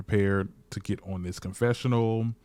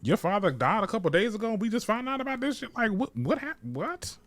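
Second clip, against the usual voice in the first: much higher, incredulous what the hell; shocked disbelief; 10 semitones higher than usual. Retold or imitated. Viewers reacting to the father's death news, mock shock. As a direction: gasp it out like you can't believe it. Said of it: "And we're like".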